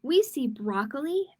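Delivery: put-on gentle storybook read-aloud for kids; reading to children. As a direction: read it like storytime for little ones. Reading a picture book aloud.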